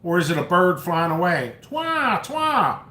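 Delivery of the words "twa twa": high taunting repeats of Twa; high jeer at the name; mocking the name. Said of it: "Yes, I'm making fun of his name".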